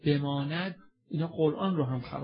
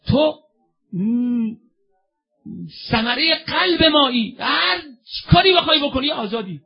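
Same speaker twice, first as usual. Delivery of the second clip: loud, high, crying-out sermon voice; fervent sermon lament; 9 semitones higher, 10 dB louder than usual. Acted. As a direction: call out to the crowd with rising fervour. Preacher's lament and exhortation.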